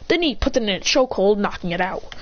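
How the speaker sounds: ordinary delivery, nothing marked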